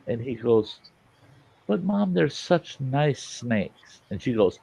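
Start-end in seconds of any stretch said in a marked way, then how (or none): 1.7-4.0 higher, slow mock child's whine; heartbroken pleading. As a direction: draw out a kid's plea to mom.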